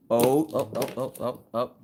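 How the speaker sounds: ordinary talk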